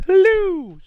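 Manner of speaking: big high, loud drawn-out entrance greeting; Criddler. Shown as a demonstration. Showing how the character always comes in, booming high greeting. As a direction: sing out the hello, swooping down at the end. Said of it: "Criddler always comes in with".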